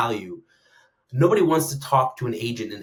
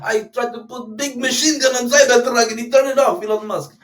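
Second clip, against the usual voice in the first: high, loud mock Chechen accent; attempted Chechen accent; 11 semitones higher, 7 dB louder than usual. Chechen warlord complaining about his Cybertruck, hammed-up Chechen accent. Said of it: "I don't know what kind of accent that was".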